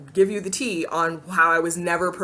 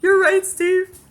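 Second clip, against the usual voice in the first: much higher, wide-eyed eager voice; mock earnest younger self; 12 semitones higher, 3 dB louder than usual. Her younger self answering Steve's advice, mock wide-eyed and much higher. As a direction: play your past self, newly inspired and earnest. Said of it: "I'm like".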